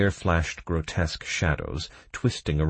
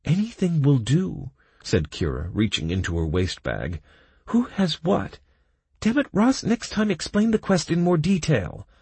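higher, louder annoyed female; scolding female voice; 11 semitones higher, 3 dB louder than usual. A narrator's reading. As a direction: scold him, pitch her up. Kira scolds Ross.

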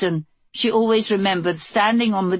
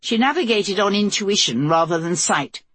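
plain reading voice

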